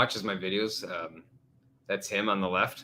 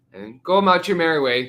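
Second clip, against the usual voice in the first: ordinary talk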